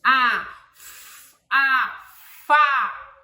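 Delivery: loud, drawn-out "A" and "Fá"; sound blending. Shown as a demonstration. Teaching a child to blend F and A, loud. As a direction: say each syllable loud and clear. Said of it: "dessas letras é mais fácil".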